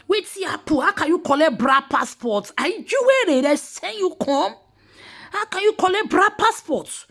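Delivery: high-pitched, baffled questioning; incredulous outburst. Acted. Commentator aghast at the passport being taken, incredulous. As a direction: demand it in high-pitched disbelief.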